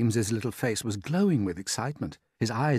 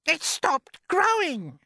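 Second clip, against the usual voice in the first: a far higher, louder aunt's shout; Aunt Spiker shouting; 6 dB louder, slower than usual. Acted. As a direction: screech it out as the nasty aunt. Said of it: "Aunt Spiker cried".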